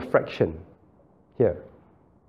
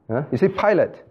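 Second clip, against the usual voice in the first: ordinary talk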